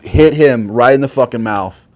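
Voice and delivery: ordinary talk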